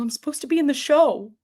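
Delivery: high, quick mock protest; put-on high-pitched objection; retold protest. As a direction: plead your case in a high, rushed voice. Protesting being kicked out.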